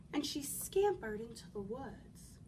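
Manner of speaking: usual speaking voice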